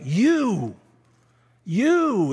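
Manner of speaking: YOU pitched high and loud; ringing, emphatic "you"; stress on YOU. Shown as a demonstration. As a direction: lean hard on "you". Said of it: "is emphasized next".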